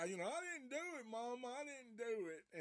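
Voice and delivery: high, pleading mock voice; Bubba. Bubba insisting to his mama, high pleading imitation. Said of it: "that's how he fucking sounded".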